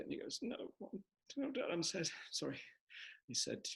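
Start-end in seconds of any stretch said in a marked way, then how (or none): 0.4-2.6 high, soft guilty protest; caught child. Acted. Baby balloon caught by his dad, high guilty protest. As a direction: squeak out a guilty denial.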